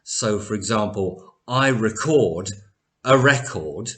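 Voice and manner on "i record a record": first "record" re-CORD, second "record" REC-ord; verb and noun stress. Shown as a demonstration. Teacher illustrates nouns after articles, "record" re-CORD then REC-ord. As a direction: shift stress in "record" from end to front. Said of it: "an article before a noun".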